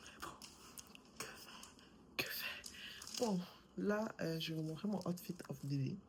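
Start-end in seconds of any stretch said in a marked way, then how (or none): 0.1-2.6 whispering. Real, not acted. Resigned muttered aside.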